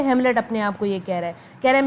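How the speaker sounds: usual speaking voice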